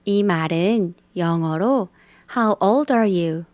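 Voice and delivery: ordinary talk, nothing marked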